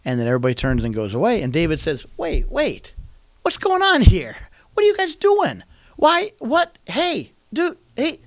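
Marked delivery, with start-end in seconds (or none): 2.1-8.2 high, urgent calls of disbelief; incredulous calling out. Retold or imitated. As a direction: call them back, baffled and high. David calls out to fleeing army.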